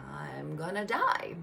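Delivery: whispered, slow, in dread; a frozen, frightened whisper; terrified whisper. Retold or imitated. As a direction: breathe it out like you're sure you'll die. Terrified inner thought.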